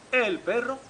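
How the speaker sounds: plain reading voice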